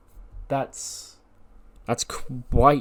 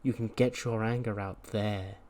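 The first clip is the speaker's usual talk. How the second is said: calm, measured menace; 4 dB quieter than usual. Acted. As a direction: answer a threat gently, with long pauses. Calm leader replies to a threat.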